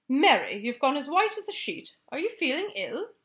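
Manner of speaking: upset Queen. Acted. Queen worried for maid.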